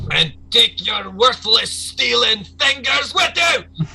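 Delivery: high, loud, drawn-out enraged yell; furious shout. Acted. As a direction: roar it after a fleeing thief. Boss throws severed fingers, yelling.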